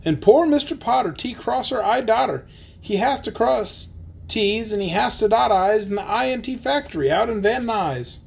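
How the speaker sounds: lively, sing-song storybook reading; bouncy picture-book narration; reading to children. Acted. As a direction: read the rhyme aloud for the kids. Reads Seuss aloud to kids.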